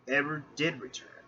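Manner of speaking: plain reading voice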